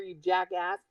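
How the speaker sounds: usual speaking voice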